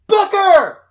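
high, very loud call-out; shouting names. Retold or imitated. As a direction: imitate two people yelling for each other. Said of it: "it's shouting at each other".

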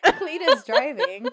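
slow, laughing through the typo; cracking up while repeating it. Real, not acted. Friend reading the typo back, laughing.

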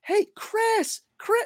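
very high-pitched hail; high mock call across a room. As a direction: imitate a woman calling someone over. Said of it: "she's calling him by name".

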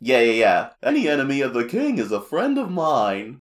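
loud, put-on cartoon voice; Chef Kawasaki. The chef siding with the king's enemy, loud impression. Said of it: "he's like".

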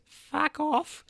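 put-on high, scornful old lady snapping; rude snap. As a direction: spit it out as a cranky old woman. Rude old woman snaps at Jack.

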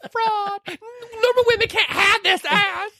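hammed-up sobbing confession, very high and slow; mock crying. Fitness star admits she's a fraud, mock wailing. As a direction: wail it out slowly, parodying tears. Retelling a tearful movie confession.

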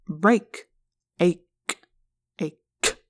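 "Break", then "Ache", each alone with a pause; playing with sounds. A narrator's reading. Alien toys with a human word, sounding it out. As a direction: savour "Ache" slowly, sound by sound.

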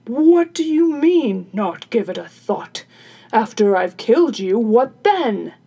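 loud carpenter's voice, objecting; indignant carpenter. Acted. Carpenter objects to killing her, loud indignant voice. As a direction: push back loudly in character.

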